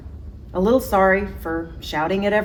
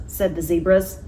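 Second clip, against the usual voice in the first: usual speaking voice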